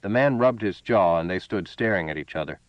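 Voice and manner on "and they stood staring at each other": ordinary delivery, nothing marked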